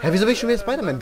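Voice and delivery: baffled quick outburst; rapid surprised complaint. Real, not acted. Surprised at his skin.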